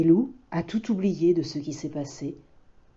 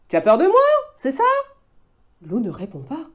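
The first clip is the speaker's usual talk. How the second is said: put-on much higher, louder child's voice; insisting boy; 10 semitones higher, 5 dB louder than usual. Boy insists the wolf come out, high and loud. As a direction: coax him out firmly. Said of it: "insiste l'enfant".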